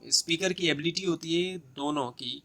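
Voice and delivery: ordinary delivery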